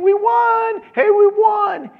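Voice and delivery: high, ringing victory cry; triumphant proclamation. Acted. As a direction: call it out like a messenger bringing news of victory. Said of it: "you might be proclaiming".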